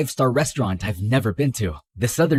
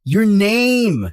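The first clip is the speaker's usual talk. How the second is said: raised, louder snap of the name; exasperated yell; 5 semitones higher, 4 dB louder than usual. Acted. Guy yelling at a cagey partner, exasperated. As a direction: push it out loud, fed up. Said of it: "Don't yell at me".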